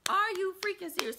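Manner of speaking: high shocked voice; shocked disbelief. Retold or imitated. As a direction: squeal it in shock. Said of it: "I'm like".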